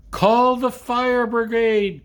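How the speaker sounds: high, loud, slow alarmed cry; frantic cry for the fire brigade; cried out. Acted. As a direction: yell for help in a panic. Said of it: "cried Missus Protherio".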